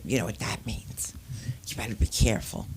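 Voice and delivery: low hushed whisper; hushed motherly whisper of warning; mother's whisper. Retold or imitated. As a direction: warn in a secret whisper. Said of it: "my mother says to me".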